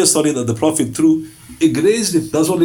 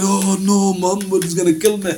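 higher, whiny mock fright; hammed-up terror; mock panic; 5 semitones higher than usual. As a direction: play the scared boaster, pitched up. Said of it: "He went".

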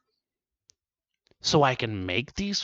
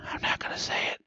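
softer and softer, to a whisper; under breath; 10 dB quieter, faster than usual. Retold or imitated. Shopper whispering to himself not to say it. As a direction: hold it in, fading to a whisper. Muttering to self, fading.

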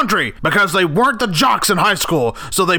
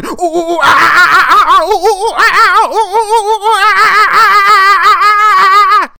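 loud high-pitched ape screeches; frantic shouted monkey calls; chimpanzee hooting; 8 dB louder than usual. Acted. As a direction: hoot and screech like a chimp sounding the alarm. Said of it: "I'm sitting in a tree going".

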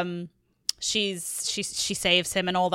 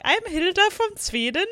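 high-pitched, badly faked Swedish accent; Hilda's bad Swedish accent; 11 semitones higher than usual. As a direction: imitate a hopeless attempt at a Swedish accent. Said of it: "she couldn't do accents to save her life".

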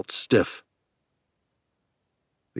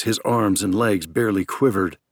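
usual speaking voice

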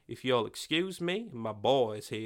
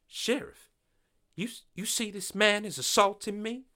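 rattled appeal; 11 semitones higher than usual. Acted. Quincy frightened, appeals to sheriff.

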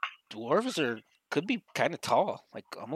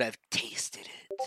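slow, hushed mock confession; teasing imitation in a drawn-out whisper; 3 semitones lower, 7 dB quieter, much slower than usual. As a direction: whisper it slowly, like a dirty secret. Teasing mock confession put on friend.

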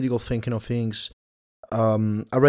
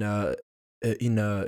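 usual speaking voice

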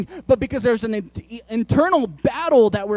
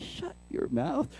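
slow, much softer stern order; hushed command; 2 semitones higher, 14 dB quieter, slower than usual. Retold or imitated. Dad hushing the kids mid-argument, slow and much softer. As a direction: keep it under your breath but firm.

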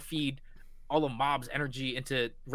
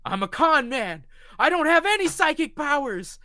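loud confession; 13 semitones higher, 8 dB louder than usual. Retold or imitated. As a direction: blurt out the truth. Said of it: "He's like".

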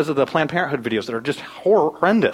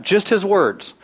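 usual speaking voice

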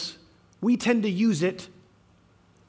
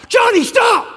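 sharp high shout, much louder; yell; 12 semitones higher, 8 dB louder than usual. Retold or imitated. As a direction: yell to stop a child running into the street. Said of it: "that is the spot to yell".

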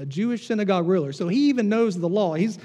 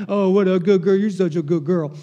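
put-on loud gushing praise for a good girl; louder pet talk; 6 dB louder than usual. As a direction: coo loudly at the dog. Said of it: "Y'all talk like that too to your pets".

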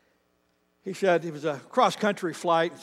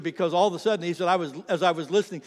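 ordinary talk, nothing marked

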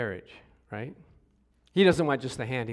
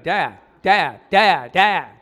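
son calling insistently; 9 dB louder, much slower than usual. Retold or imitated. Son calling father repeatedly.

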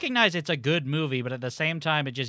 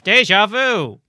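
loud, higher exclamation; loud bit; 7 semitones higher, 11 dB louder, slower than usual. Pretend. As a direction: blurt it out. Doing the bit, burst out.

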